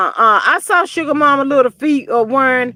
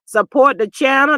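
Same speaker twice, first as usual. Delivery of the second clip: ordinary talk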